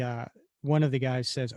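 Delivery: ordinary talk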